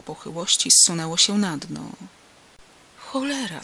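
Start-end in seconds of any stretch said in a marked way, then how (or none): 3.0-3.6 high, mildly irritated curse; understated swearing, pitched up; cursing. A narrator's reading. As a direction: curse an accomplice in annoyance. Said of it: "zaklął jeden".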